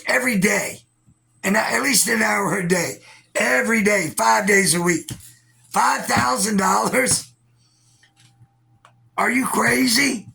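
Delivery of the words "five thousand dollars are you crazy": incredulous. Real, not acted. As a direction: shout in disbelief. Ranting at donors, incredulous.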